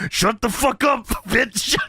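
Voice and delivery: high mock shout broken by laughing; playful imitation of an angry outburst; mock angry yell. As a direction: snap it as a hammed-up insult. Acted angry wrestler retort.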